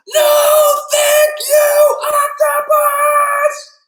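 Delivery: very high, loud, drawn-out yell; frustrated scream. Acted. Boy at last straw with friend, upset scream. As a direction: scream each word in exasperation. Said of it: "the boy is so frustrated".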